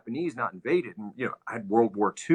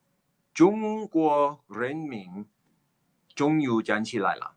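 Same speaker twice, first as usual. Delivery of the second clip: slow, less heightened proclamation; a second, milder go at Mao; a toned-down Mao proclamation; lighter Mao impression; 5 semitones higher, slower than usual. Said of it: "it's my Chairman Mao impression".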